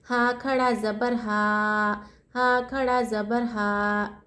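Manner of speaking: very slow, final हा held one alif length; teacher models the standing zabar length; stretched हा. Shown as a demonstration. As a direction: drag the हा out for one count. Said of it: "एक अलिफ की मकदार खींचेंगे".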